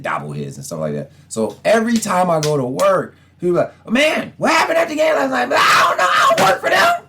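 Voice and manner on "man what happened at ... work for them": exasperated protest. Retold or imitated. Fan protesting to coworkers about last night's game, high exasperated. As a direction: whine it out, pitched way up.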